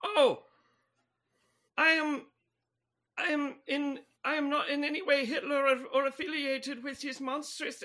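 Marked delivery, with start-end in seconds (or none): none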